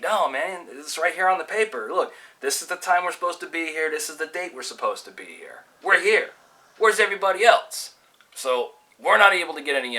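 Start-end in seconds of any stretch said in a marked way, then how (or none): 0.0-7.9 higher-pitched mock arguing student; acted-out baffled protest; retold indignant protest. Acted puzzled argument among waiting students.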